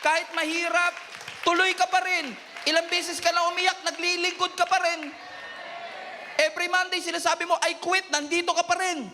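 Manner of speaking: rising fervent shouts of encouragement; preaching at full force. Acted. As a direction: preach it hard, lifting the room. Preacher builds to climax.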